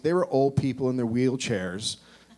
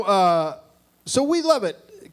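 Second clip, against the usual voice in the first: ordinary talk, nothing marked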